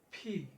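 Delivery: ফি as p with a puff of air; aspirated P. Shown as a demonstration. Teacher modelling letter P for pupils, aspirated. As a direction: burst breath through the lips on ফি. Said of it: "বাতাস আসতে হবে".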